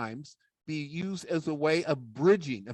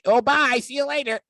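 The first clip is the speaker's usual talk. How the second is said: puppet sidekick; 8 semitones higher, 5 dB louder than usual. Acted. Puppet sidekick says goodbye to the Toastmasters audience in a high voice. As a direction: promise to be good like a cheeky puppet.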